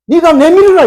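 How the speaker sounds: loud high command; divine command. Acted. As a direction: fling the order out.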